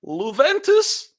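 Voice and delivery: hammed-up results read with wild high laughter; high, loud mock disbelief, breaking into cackles and a whoa; imitating Ghanaian journalist. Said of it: "as he read that".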